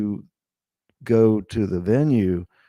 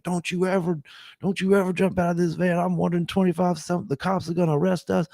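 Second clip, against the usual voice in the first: higher-pitched imitation of a panicking driver; imitated freak-out; 8 semitones higher than usual. Panicked driver scolding his passengers in the van, retold freak-out. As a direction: retell his frantic warning, voice pitched up. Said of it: "he's freaking out".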